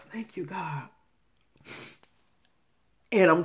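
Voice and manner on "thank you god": breathy whisper of joy to God; a hushed, delighted thank you. Real, not acted.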